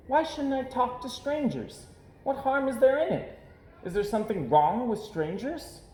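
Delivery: high, bright little cub voice, greeting everyone; cheery little girl. Acted. Little Sister greeting every passerby, cheery high girl voice. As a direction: sing out hello to every passerby.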